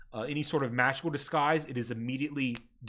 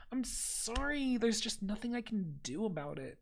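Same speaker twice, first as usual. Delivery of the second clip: mock sorry clerk; 6 semitones higher, 8 dB quieter than usual. Clerk turns the visitor away with fake sympathy. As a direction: feign regret while refusing.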